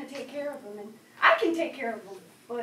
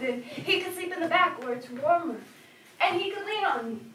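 ordinary delivery

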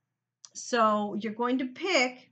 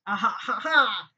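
real laugh; 5 dB louder than usual. Real, not acted. Laughs at herself.

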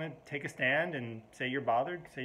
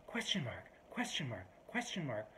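mock passive-aggressive reply; 7 dB quieter than usual.

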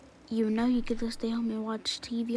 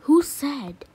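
loud, higher challenge; demanding shout; 4 semitones higher, 11 dB louder than usual. Acted. Child demanding who said they have covid, shouting. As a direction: hurl the question back.

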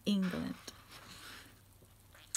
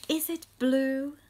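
loud questioning; 4 semitones higher, 8 dB louder than usual. Acted. Reading questions to children.

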